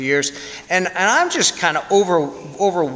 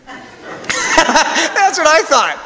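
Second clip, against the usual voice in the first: slow, laughing words pitched much higher and louder; 12 semitones higher, 4 dB louder, much slower than usual. Real, not acted. Priest laughing about the dying man's vow, slow and high with laughter. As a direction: laugh your way through it.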